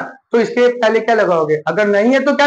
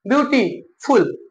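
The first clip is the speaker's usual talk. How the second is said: Beautiful slowly as beauty, gap, फुल; broken into syllables; slower than usual. Shown as a demonstration. Teacher counting syllables for students, word slowly broken in parts. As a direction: split beautiful into separate beats. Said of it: "दो syllable में".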